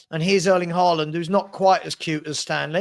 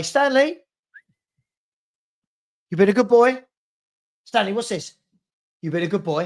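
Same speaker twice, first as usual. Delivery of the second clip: lifted, louder coo to the dog; doting puppy talk; 2 semitones higher, 3 dB louder than usual. Real, not acted. Owner cooing at his puppy Stanley, raised and loud. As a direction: fuss over the puppy.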